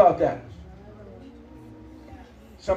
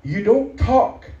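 stern warning; 10 semitones higher, slower than usual. Acted. Pastor warning the congregation against cursing God's people, slow stern preaching. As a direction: lay it down slowly like a warning.

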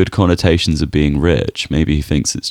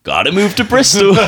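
much higher, urgent, half-laughing; panicked high exclamation; 13 semitones higher, 3 dB louder than usual. Pretend. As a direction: blurt it out in sudden panic. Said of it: "You're like".

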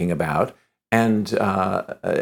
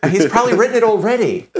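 much higher and louder, laughing through it; exasperated refusal; 11 semitones higher, 6 dB louder than usual. Retold or imitated. Refusing the producer, high and laughing. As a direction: protest with amused exasperation. Retelling his own refusal.

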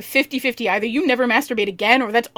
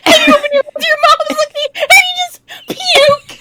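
high, giggly telling; words squeezed out through laughter; laughing through it; 13 semitones higher, 4 dB louder than usual. Real, not acted. Friend pictures the puking scene, laughing high.

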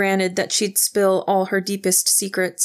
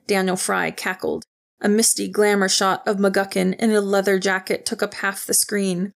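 ordinary delivery, nothing marked

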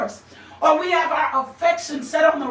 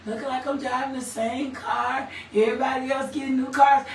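low muttered grumble, picking up speed; mock whining; 3 semitones lower, 4 dB quieter than usual. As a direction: imitate a self-pitying complainer. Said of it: "That is whining".

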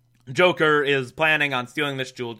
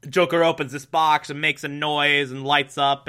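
usual speaking voice

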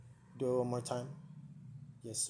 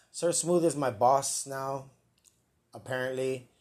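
ordinary delivery

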